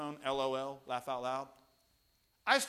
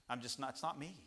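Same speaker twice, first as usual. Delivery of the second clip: ordinary delivery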